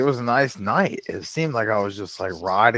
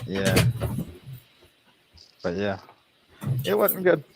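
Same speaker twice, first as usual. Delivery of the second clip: ordinary talk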